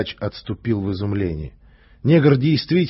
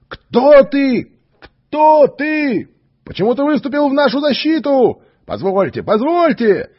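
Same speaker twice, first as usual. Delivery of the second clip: much higher, loud calling out; excited crowd calls; 7 dB louder than usual. Acted. The crowd calls to the stranger, high and loud. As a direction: shout up to the stage from the crowd.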